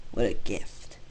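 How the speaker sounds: plain reading voice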